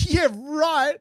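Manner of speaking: high, sarcastic scoff; scornful dismissal. Retold or imitated. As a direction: brush off the devil with mock disbelief. Dismissing the devil's suggestion.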